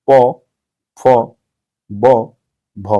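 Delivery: प, फ, ब, भ said loud and separately from the lips; labial sounds. Shown as a demonstration. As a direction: demonstrate lip-made प, फ, ब, भ, a pause after each. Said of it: "ये ओष्ठ है".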